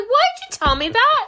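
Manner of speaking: high, loud mock wail; distressed protest. Diner protesting to the host about the meat, high loud wail. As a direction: wail it in hammed-up dismay. Retold protest at learning meat's origin.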